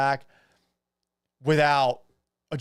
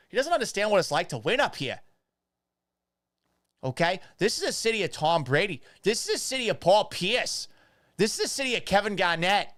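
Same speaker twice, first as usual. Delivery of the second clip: irate Boston fan, higher and worked up; Boston accent; 5 semitones higher than usual. Acted. Boston fan defending his city from a newcomer, Boston accent. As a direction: rant in a played-up Boston accent, punching the names. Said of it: "That's how you do a Boston accent".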